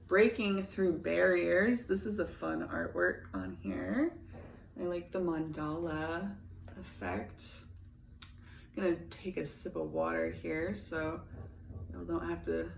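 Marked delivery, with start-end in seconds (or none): 1.9-12.7 husky morning voice; just woke up, morning voice. Real, not acted. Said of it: "suffer through my morning voice".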